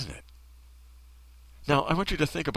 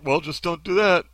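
higher, louder glib dismissal; mocking, pitched-up brush-off; vapid mock advice; 6 semitones higher, 5 dB louder than usual. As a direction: parody empty-headed advice. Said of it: "something as vapid as that".

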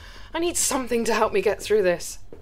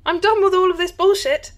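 angry; 6 semitones higher, 4 dB louder than usual. Acted. Fed up with being toyed with.

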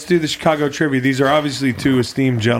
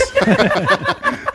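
high laugh. Real, not acted. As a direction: burst of high-pitched laughter. Big laugh at own joke.

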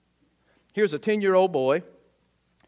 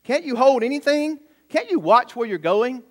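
high-pitched mock scolding; stern; 6 semitones higher than usual. Boy scolds the classmate who tripped, stern. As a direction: act out a boy sternly telling someone off. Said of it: "he says to her sternly".

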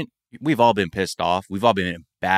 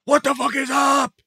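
mock aggressive yell, much higher; hostile shout. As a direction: bark it at a stranger like a hothead. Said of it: "coming up to somebody distressed and be like".

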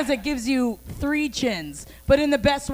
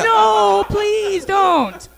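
mock pleading; 8 semitones higher, 5 dB louder, much slower than usual. Imitating tased man.

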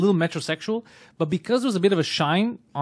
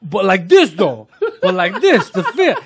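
laughing mock insist; 6 dB louder, slower than usual.

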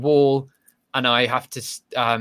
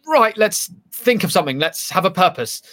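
mock resolve; 6 semitones higher than usual. Voices own brain's brisk resolve.

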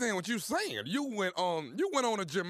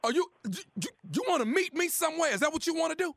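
angry father; 9 semitones higher, faster than usual. Pretend. Prank caller playing angry father.

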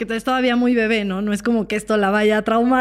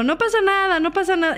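put-on higher, breezy brush-off to the kid; dismissive reassurance; 7 semitones higher, faster than usual. Parent brushing off son's missed goal, higher breezy tone. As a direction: wave the pain away lightly. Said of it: "si yo le digo".

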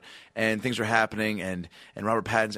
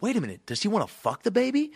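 high-pitched mock disbelief; incredulous question; 10 semitones higher than usual. Moviegoer questioning friend about the plot, incredulous. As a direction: ask it high, as if appalled. Retelling his shock at the plot.

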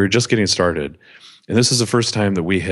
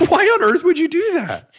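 much higher, incredulous wife voice; alarmed wife; 4 dB louder than usual. Retold or imitated. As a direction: play the shocked wife, pitched way up. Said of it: "She's like".

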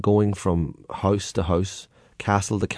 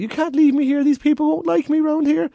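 put-on high, desperate old man; pleading stowaway; 3 dB louder than usual. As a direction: beg not to be left. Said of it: "he's like".

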